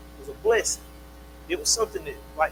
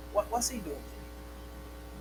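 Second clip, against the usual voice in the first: hushed questioning; 13 dB quieter, much slower than usual. Acted. God questioning Lot's presence.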